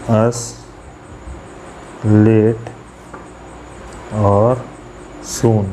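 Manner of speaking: word by word with long pauses between; scanning the line. Shown as a demonstration. Teacher scanning the poem line for students, word by word. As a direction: lay out each syllable's beat for the class. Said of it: "सबसे पहले एक example हमने लिखा था".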